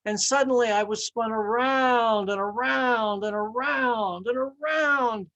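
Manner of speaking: dizzy spinning. Acted. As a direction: climb in pitch as the spinning goes on. Teacup spinning on the wheel.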